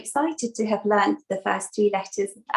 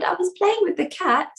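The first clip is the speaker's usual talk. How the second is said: put-on high-pitched child's reply; child Ganesh answering; 8 semitones higher than usual. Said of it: "And Ganesh said".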